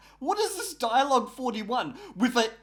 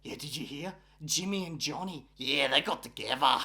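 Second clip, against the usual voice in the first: gossiping walls; 5 semitones lower, 4 dB quieter than usual. Pretend. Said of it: "The walls are like".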